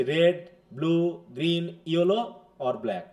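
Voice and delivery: slow "red, blue, green, yellow", each colour its own unit; word-by-word list. Shown as a demonstration. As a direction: set each colour apart, leaving "or" unstressed and ending on "black". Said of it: "আমরা কি করছি?".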